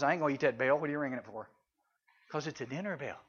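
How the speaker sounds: low, soft grumbling dog voice; dog's voice. Retold or imitated. Preacher voicing a puzzled dog, low and soft.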